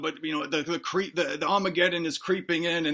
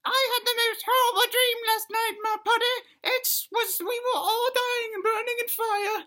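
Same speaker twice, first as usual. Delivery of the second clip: very high, squeaky little dinosaur voice; high falsetto kid, telling a nightmare; little dinosaur child. Acted. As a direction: squeak it out like a distressed tiny dino. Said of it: "little Timmy Dinosaur goes".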